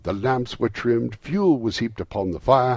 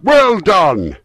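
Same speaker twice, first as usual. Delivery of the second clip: high, loud hearty cheer; booming approval; crying out; 11 semitones higher, 11 dB louder than usual. A narrator's reading. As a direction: hail the dancers with a jovial shout. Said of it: "cried out".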